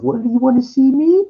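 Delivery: high falsetto imitation of a woman's voice; woman on the phone. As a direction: squeak it up high like the caller. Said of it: "she goes".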